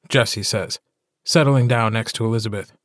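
ordinary delivery

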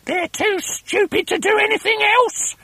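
very high, scornful shriek; screechy high-pitched wife, full of scorn; Mrs Twit's voice. A narrator's reading.

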